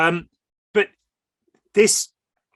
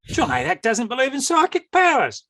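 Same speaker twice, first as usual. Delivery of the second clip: put-on higher Australian accent quoting a critic; 10 semitones higher than usual. Telling the host about his Australian critic, in an Australian accent. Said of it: "I'm doing the Australian accent".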